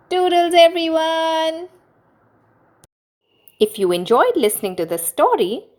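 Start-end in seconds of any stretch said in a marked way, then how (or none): 0.1-1.7 high, loud, bubbly farewell; cheery goodbye. Real, not acted. Cheery sign-off to listeners.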